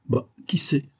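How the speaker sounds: hint at something with a sneer. A narrator's reading. Cardassol needles Victor about his advice, sneering. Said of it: "ricana monsieur de Cardassol".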